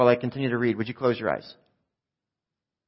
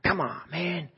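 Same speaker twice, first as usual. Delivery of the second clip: hushed, higher-pitched complaint; annoyed moviegoer; 5 semitones higher, 7 dB quieter, slower than usual. Acted. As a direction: grumble at talkers in the cinema, keeping it down. Annoyed moviegoer shushing talkers.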